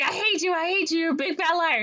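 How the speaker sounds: very high-pitched mock tantrum; upset kid. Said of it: "and he's very upset".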